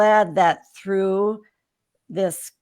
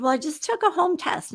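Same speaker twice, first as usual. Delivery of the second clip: raised, matter-of-fact retort; defensive reply; 10 semitones higher than usual. Retold or imitated. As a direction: insist on it a little pointedly. Answering husband's doubt.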